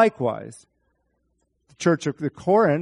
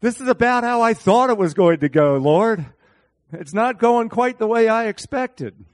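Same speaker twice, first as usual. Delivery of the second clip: mock trembling Moses; 6 semitones higher, 5 dB louder than usual. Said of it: "trembling a little bit".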